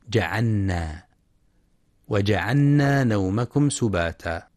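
جعلنا with the lam merged into the nun, said جعنّا; wrong merged reading. Shown as a demonstration. Teacher imitates a student's error, lam merged into nun. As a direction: demonstrate the mistake: جعلنا as جعنّا. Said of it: "يخطئ من يدغم اللام في النون".

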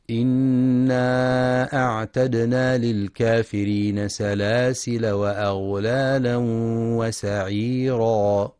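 slow, drawn-out melodic tartil; slow Quranic recitation. Acted. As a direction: chant the verse with measured tajweed.

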